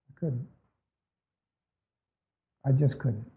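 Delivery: near-silent admission of real grief; real hushed grief. Real, not acted. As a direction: let the words sink away in real pain. Recalls grief he couldn't bear.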